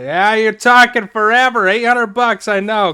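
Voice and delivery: loud, high, fed-up yelling; cutting off a chatty dealer with a shout; annoyed shout. Real, not acted. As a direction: shout him down for talking forever. Shouting down the long-winded NPC.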